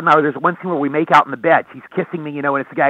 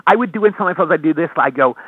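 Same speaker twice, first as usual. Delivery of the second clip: ordinary talk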